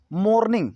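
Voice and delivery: "मॉर्निंग" with a round closed 'mo', MOHR-ning; regional mispronunciation. Shown as a demonstration. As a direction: say "मॉर्निंग" as Haryana-Delhi speakers do, MOHR-ning. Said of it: "ये ज़्यादातर Haryana side". ("मॉर्निंग" को 'मोर्निंग' बोलें, गोल 'ओ')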